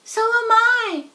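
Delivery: high, loud piping voice; a third little spider joins in; third tiny voice. A narrator's reading. Third baby spider answers Wilbur, high and loud. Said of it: "said a third voice".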